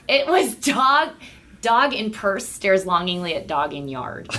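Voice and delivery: slow, deliberate headline read; slow headline delivery. Shown as a demonstration. Reading her headline to the podcast hosts, slow and deliberate. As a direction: read the headline slowly, letting it land. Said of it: "I don't even know if you'll think this is funny".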